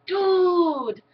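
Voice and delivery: high, loud exaggerated accented "Dude"; imitating his accent. Imitating the boy in her grade with an accent. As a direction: mimic a classmate's accent. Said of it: "he has an accent".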